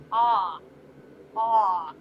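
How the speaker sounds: "Ah" as the A in farm; soft German A. Shown as a demonstration. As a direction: sound out "Ah" with an open A, not as in hate. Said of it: "like in farm".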